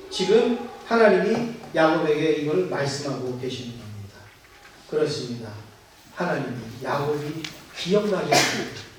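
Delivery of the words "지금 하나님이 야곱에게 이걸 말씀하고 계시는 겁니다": ordinary delivery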